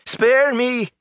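high, strained plea for mercy; panicked high-pitched wail; terrified cry. A narrator's reading. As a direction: cry out begging for your life. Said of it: "he cried".

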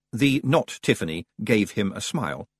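ordinary delivery

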